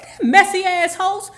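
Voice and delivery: loud, high indignant outburst; outraged. Acted. Comedian cursing the host who crushed Mary, outraged. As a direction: yell it in comic outrage.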